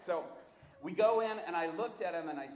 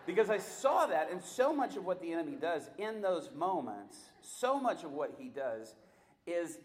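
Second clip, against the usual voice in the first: ordinary talk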